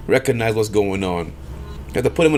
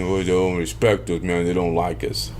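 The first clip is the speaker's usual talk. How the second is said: lower, mocking voice of a complainer; mocking people who always complain, in a low whiny voice; mock whiny complaint; 4 semitones lower than usual. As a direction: lightly imitate a protester whining.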